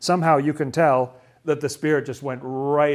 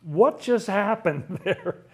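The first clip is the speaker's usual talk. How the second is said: higher, drawn-out incredulous questions; amazed; 4 semitones higher, slower than usual. Acted. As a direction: act out stunned onlookers with a laugh breaking in. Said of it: "Then they were all amazed".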